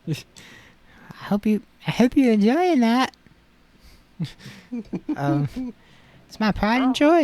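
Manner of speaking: high Lickitung voice with the tongue out; tongue sticking out. Acted. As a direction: keep the tongue sticking out while talking. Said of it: "talk to the tongue sticking out".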